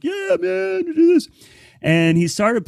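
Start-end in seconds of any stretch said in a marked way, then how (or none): 0.0-1.3 much higher, cheering friends voice; cheering buddies. Retold or imitated. Friends cheer the boss on, mocked in a much higher voice. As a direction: egg him on like rowdy buddies, pitched way up. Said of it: "his friends are like".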